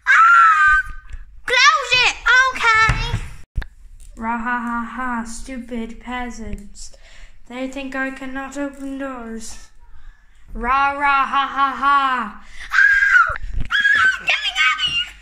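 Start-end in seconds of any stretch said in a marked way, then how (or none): 0.1-0.8 piercing high shriek; high panicked scream. Pretend. Toy character screams in panic. 4.2-12.4 low, menacing monster. Acted. Giant voice gloating. 12.7-15.1 very high panicked shriek; terrified high screaming. Pretend. Character trapped, screaming.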